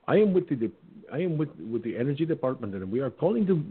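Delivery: put-on stumbling caller with an Indian accent; Indian accent impression. Scammer introduces himself on a house call, Indian accent. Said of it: "I can do an Indian accent impression".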